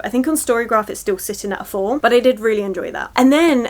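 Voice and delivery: ordinary delivery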